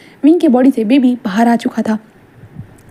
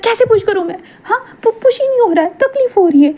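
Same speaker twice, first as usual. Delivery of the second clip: high crying in pain; 10 semitones higher than usual. Acted. Wing crying can't push.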